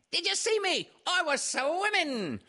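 very high, excited squeak of a little kangaroo; squeaky Roo. A narrator's reading. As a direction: squeal it out like a proud little joey. Roo's voice.

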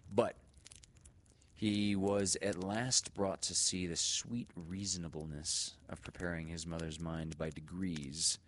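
ordinary delivery, nothing marked

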